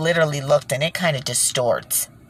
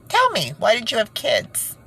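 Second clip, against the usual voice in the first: indignant demand; 8 semitones higher than usual. Real, not acted. Demanding answer from Hillary.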